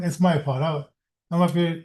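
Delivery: ordinary talk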